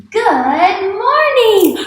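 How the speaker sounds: high, loud, drawn-out excited greeting; excited exclamation. Shown as a demonstration. Teacher models an exclamation mark for class, excited. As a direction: read it big and excited, stretching the words. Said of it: "don't forget to stand up and be excited".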